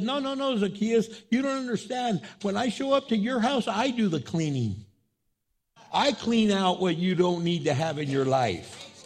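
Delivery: high, emphatic correction; insistent reassurance. Acted. Jesus tells Zacchaeus he does the cleaning, high and insistent. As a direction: insist that you will handle it all.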